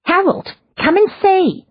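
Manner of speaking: very high call from a window; excited call. A narrator's reading. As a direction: call out to Harold upstairs. Neighbour calling from window.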